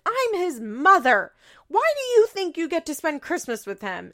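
hammed-up outraged mother; mock indignant Janelle. Janelle claiming Christmas with her son, mock indignant. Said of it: "And Janelle's like".

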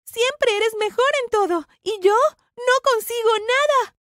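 high, loud, drawn-out grievance; angry yelling. A narrator's reading. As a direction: yell back with pent-up resentment. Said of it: "también comencé a gritarle". (gritos de rabia, agudos y resentidos)

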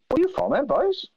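high-pitched, wrong accent for the impression; high Rumney-style accent, badly missing the target; botched impression accent. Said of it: "Not that accent".

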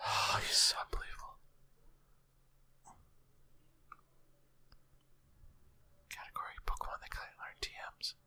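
whispered under the breath; whispering. Real, not acted. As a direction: mutter it to yourself. Muttering to himself while searching.

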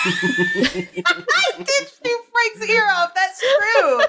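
laughing. Real, not acted. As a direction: crack up while admitting it. Laughing at shooting Frank's ear.

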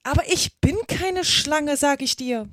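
calling out. A narrator's reading. Alice protesting to the pigeon, crying out. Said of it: "rief Alice".

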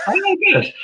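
squeaky mock dismay. Commentator left to do the game alone, squeaky dismay. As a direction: squeal it in feigned cheerfulness.

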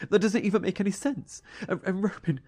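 panicked, racing as it sinks in; rising panic. Acted. Elliot panics over brother's fate.

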